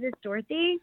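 rising at the end like a question; uptalk. Real, not acted. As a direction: say your name as if asking. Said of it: "your voice goes up at the end".

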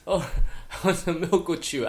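high kid imitation breaking into laughter; Connor's catchphrase. Mimicking a child's new favorite word, laughing kid voice. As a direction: play the child, barely holding back laughs. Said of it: "So he'll just be like".

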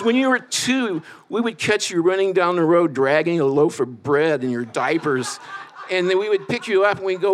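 lightly voiced mom, chuckling; mom voice laughing. Mom retells baby story, laughing.

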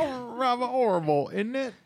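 very high, hammed-up British; cartoon British parody; mock British accent. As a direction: squeal it in fake British. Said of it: "knows what British people sound like".

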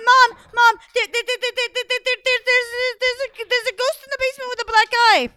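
high, loud panicked stutter; terrified stammer. Acted. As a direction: stammer in fright to Mom. Terrified boy stammering about ghost.

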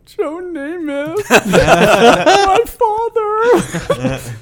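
high, slow, laughing through a mock sob; mock sobbing. Retelling crying to his wife, high mock sobbing with laughter. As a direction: ham up the blubbering, breaking into laughs. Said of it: "And I'm going".

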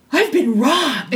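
gasp, then drawn-out mock shock; hammed-up stunned discovery; sudden shocked realization. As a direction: act out it finally sinking in. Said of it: "it struck me, like, really what had happened".

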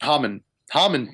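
Hamun said HAH-mun, like hamantash; first vowel as "ah". Shown as a demonstration. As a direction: open "ah", short second syllable in Hamun. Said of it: "Like Hamuntosh".

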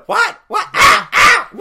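very high, loud yelps; mock screaming. Kid overheard getting beaten by his mom, mock screaming. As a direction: imitate a kid wailing under a beating. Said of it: "you could hear him going".

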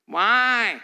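put-on whiny child's drawn-out why. Kid whining at a parent for reasons. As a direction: whine like a toddler. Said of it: "the whiny baby why".

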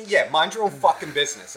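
acted-out sharp rebuke, high and loud; angry retort. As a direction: imitate an irate mother cutting someone off. Acting out mother's hostile reply again.

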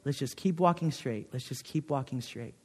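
soft, steady calming voice, fading very quiet; older pastor guiding a frightened friend along the dark road, soft and steady. Retold or imitated. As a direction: guide a friend through the dark gently. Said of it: "my friend's voice was so calm".

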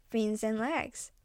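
usual speaking voice

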